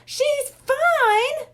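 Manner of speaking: high loud yell of disbelief. A narrator's reading. Bradley yelling at his brother in disbelief. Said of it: "Bradley yelled".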